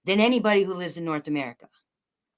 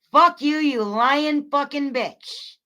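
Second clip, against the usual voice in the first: higher, louder and slow, each word dragged; slow furious insult; 5 semitones higher, 4 dB louder, slower than usual. Real, not acted. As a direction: drag out every word of the insult with fury. Cursing out a troll.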